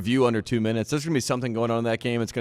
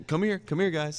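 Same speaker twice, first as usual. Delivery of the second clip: higher, quick beckoning call; coach calling players over; 6 semitones higher, slower than usual. Acted. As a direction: wave the team in with your voice.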